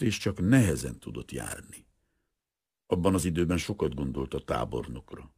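usual speaking voice

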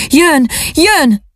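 high-pitched crowd cry; kiáltás. A narrator's reading. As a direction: call out the news, pitched high. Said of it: "mint az áradat a kiáltás". (magas hangú, izgatott kiáltás)

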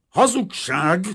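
high, very loud accusation; foaming rage. A narrator's reading. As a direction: explode with fury. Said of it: "tajtékozott a festő".

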